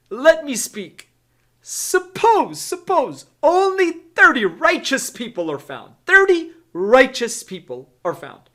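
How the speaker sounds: high, loud insistent pleading; Abraham. Acted. Abraham pressing the Lord for thirty, high pleading. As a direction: beg with a stretched, high "suppose". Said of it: "Abraham pleaded".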